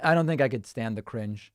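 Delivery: ordinary talk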